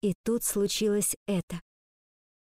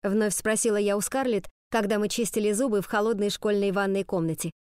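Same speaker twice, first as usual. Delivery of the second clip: ordinary delivery, nothing marked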